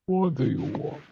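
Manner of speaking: put-on thick Russian accent, gruff. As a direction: speak it with a heavy Russian accent. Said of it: "my shitty Russian accent".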